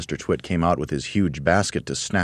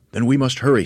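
higher, eager monkey voice; Mugglewump, higher; 8 semitones higher, 5 dB louder than usual. Acted. Mugglewump asking the roly-poly bird, eagerly higher. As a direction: ask with a monkey's urgency.

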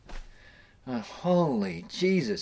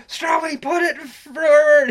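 high, loud, slow drawn-out order; imitated skipper calling the shift; commanding skipper; 10 dB louder, slower than usual. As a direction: lean on every word like a captain.

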